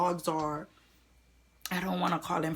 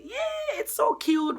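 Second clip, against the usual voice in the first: gushing; slower than usual. Retold or imitated. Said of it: "I'll say".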